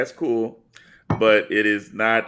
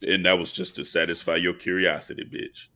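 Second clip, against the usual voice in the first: much lower and softer smooth charmer; Billy Dee Williams; 7 semitones lower, 4 dB quieter than usual. Retold or imitated. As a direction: croon it low like a smooth movie star. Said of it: "I put on my Billy D Williams".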